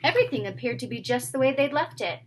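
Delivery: plain reading voice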